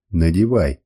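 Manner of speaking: low, firm order; stern command. A narrator's reading. Captor orders the woman to put the garment on, stern command. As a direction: give a curt, low-pitched command. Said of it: "приказал я".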